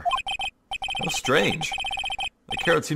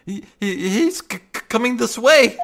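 stammer, rising higher and louder; stammering fright; 8 semitones higher, 4 dB louder than usual. Acted. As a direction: panic building to a cry. Tiger approaches.

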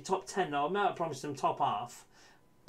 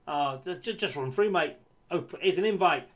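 rising, louder cries at the screen; will the striker through on goal; hopeful shouts at a through ball; excited urging; 3 semitones higher, 6 dB louder than usual. Real, not acted. Urging player to run through.

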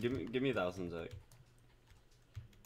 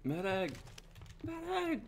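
desperate high yell for a medic; shouting while wounded; 13 semitones higher, 3 dB quieter, slower than usual. Pretend. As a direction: cry out for help, pitch climbing. Calling for medic after dying.

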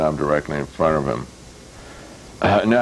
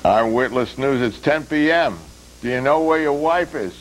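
hammed-up late-night news announcer; mock news anchor; 8 semitones higher than usual. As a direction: sell it like a cheesy TV news promo. Another mock news promo.

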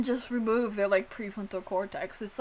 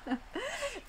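breaking into laughter; 9 dB quieter than usual. Real, not acted. Laughs mid-joke.

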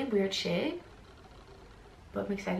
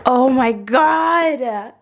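loud, drawn-out joyful cry; much louder slow joyful cry; excited shout; 3 semitones higher, 13 dB louder, much slower than usual. Real, not acted. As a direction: belt it out in delight. Shoes fit perfectly.

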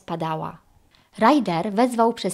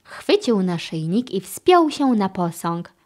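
usual speaking voice

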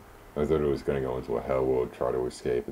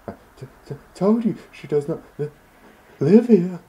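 put-on high, stammering old lady voice climbing louder; nervous old lady; 9 dB louder than usual. Landlady stammering at the debt collector, high and flustered. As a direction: stutter out the denial, panicking higher.

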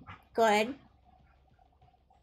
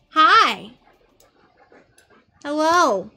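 louder hello; greeting someone loudly; 2 semitones higher, 10 dB louder, much slower than usual. Real, not acted. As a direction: call out a greeting. Loud greetings to arriving friend.

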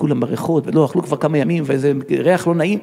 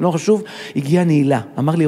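ordinary talk, nothing marked

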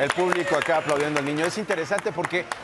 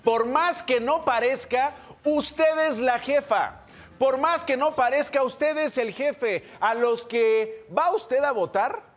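stump-speech delivery, pitched up and stretched out; emphatic address to voters; 7 semitones higher than usual. Real, not acted. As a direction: drive it home to the voters, drawing out key words.

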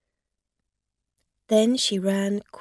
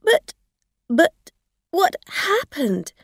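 high, loud, halting alarm; panicked stammer; 10 semitones higher, 6 dB louder than usual. A narrator's reading. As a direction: stammer the question in shock. Shocked driver finds injured woman.

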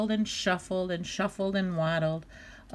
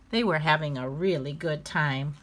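usual speaking voice

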